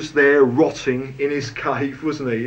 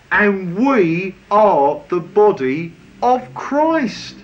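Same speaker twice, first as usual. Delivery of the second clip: slow and a bit louder, leaning on Christ; slow emphatic declaration; 2 semitones higher, 3 dB louder, slower than usual. Shown as a demonstration. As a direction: proclaim it word by word. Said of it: "where the emphasis really is".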